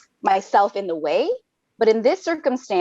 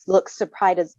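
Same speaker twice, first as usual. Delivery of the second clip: usual speaking voice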